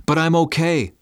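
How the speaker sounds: high-pitched, insistent objection; protesting. Acted. Father objecting to the medic, protesting. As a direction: push back, pitch jumping up. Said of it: "I protested".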